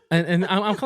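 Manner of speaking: ordinary talk, nothing marked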